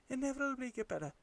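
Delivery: quick, high, hammed-up British accent on "inevitably get better"; horrible British accent. Shown as a demonstration. Advising new comics, he slips into a hammy British accent. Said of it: "saying it with a horrible British accent".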